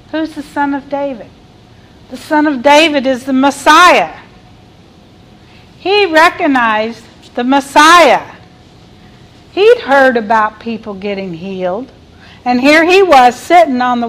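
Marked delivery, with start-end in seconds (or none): none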